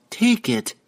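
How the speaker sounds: high, sharp insistence; sharp demand. A narrator's reading. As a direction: snap the order at him. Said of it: "Regis demanded sharply".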